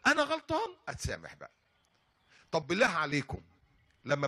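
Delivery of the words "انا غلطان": emphatic admission. Shown as a demonstration. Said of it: "فلما نقول".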